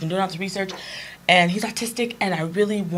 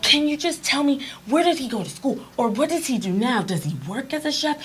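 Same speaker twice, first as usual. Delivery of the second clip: put-on a light, higher-pitched eager boy; mother retelling an excited boy's questions, eagerly; 8 semitones higher than usual. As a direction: bounce the questions out like a thrilled kid.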